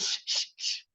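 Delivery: whispered shushing. Retold or imitated. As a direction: hush her with a whisper. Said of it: "And he goes".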